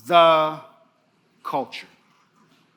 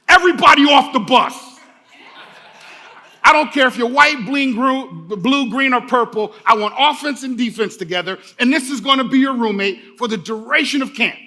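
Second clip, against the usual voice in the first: much higher and louder, a coach barking orders at his team; Denzel Washington impression; 6 semitones higher, 7 dB louder than usual. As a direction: boom it out like a movie football coach taking charge. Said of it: "my Denzel Washington, Coach Boone impersonation".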